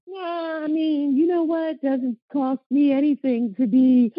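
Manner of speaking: high, loud mock-sweet voice; Miss Nicey Nicey. Co-host teasing her friend for staying nice, mock Miss Nicey Nicey. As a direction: put on an exaggerated, high goody-goody voice. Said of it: "all Miss Nicey Nicey".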